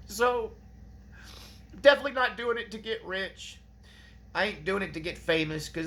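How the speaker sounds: high, teary voice on the verge of crying; choked up. Real, not acted. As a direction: push through the words while moved to tears. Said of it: "I'm trying not to cry".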